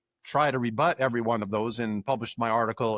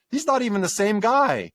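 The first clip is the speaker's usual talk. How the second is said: higher-pitched, amazed student; mock incredulity; 7 semitones higher, 4 dB louder than usual. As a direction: imitate someone baffled by the mismatch. Quoting a puzzled student comparing books.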